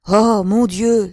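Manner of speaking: loud, drawn-out despairing exclamation; old man's anguished appeal to heaven; hoarse outcry. A narrator's reading. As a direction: cry out in despair, stretching the words. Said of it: "d'une voix rauque".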